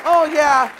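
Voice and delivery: loud mock "oh yeah"; mock doubtful listener. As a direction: mimic the sceptics in the room.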